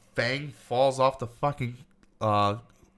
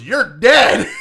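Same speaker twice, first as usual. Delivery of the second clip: louder, higher yell; threatening shout; 7 semitones higher, 10 dB louder than usual. Retold or imitated. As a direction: bellow it at the enemy. Fight scene in the film.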